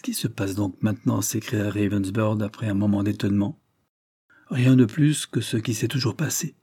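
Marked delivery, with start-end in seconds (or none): none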